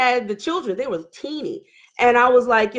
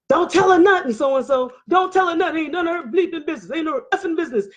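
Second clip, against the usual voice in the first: higher, insistent mimicked voice; agitated order; 5 semitones higher, faster than usual. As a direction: cut in insistently, pitched up. Husband interrupts, orders mistress silent.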